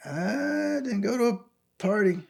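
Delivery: high, drawn-out baffled protest; retold bafflement. Waking stranded, protests to a stranger about the party, high and baffled. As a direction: stretch it out high in disbelief.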